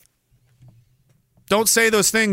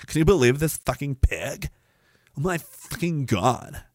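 lightly voiced scandalized critic; mock disgusted aside; 4 semitones lower, 5 dB quieter than usual. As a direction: mutter in mock outrage.

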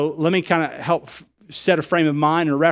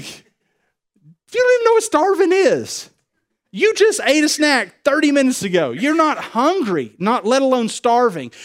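much higher, slow, incredulous scolding; exasperated dad; 10 semitones higher, 5 dB louder, slower than usual. Retold or imitated. Dad scolding his little ones who just had a snack, exasperated. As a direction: let the disbelief stretch every word.

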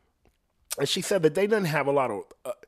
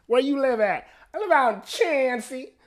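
high, loud imitation of small-town Ohio locals; hammed-up rural Ohio accent, pitched way up; mock local accent; 13 semitones higher, 6 dB louder than usual. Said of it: "that's how they say that".

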